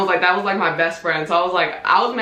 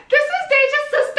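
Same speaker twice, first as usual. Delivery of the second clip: much higher announcing voice; mock introduction. Family introducing the new sister, high announcing voice. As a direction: present her like big news. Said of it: "They was like".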